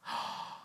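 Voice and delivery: short breathy shocked gasp; a quick unvoiced gasp of shock; retold gasp of disbelief; mock gasp. Reacting to car sold text.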